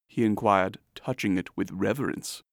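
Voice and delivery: usual speaking voice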